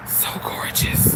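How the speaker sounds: breathless whisper of awe; hushed awe; awed whisper. Real, not acted. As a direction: whisper it in wonder.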